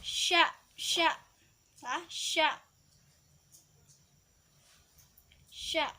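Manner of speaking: "Sya" with a thick, full sh, cut short; dibaca tebal. Shown as a demonstration. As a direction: push a strong sh into "Sya". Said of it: "sin harus dibaca tebal". ("Sya" dengan bunyi syin tebal)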